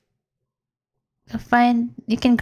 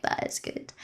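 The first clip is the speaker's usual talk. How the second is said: whispered; 14 dB quieter than usual. Retold or imitated. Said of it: "I'm like".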